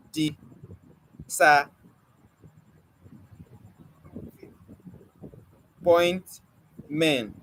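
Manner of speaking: Di- sa- point men, each syllable cut off with pauses; syllables broken apart. Shown as a demonstration. Teacher splitting disappointment into separate syllables with pauses. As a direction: chop the word into separate beats.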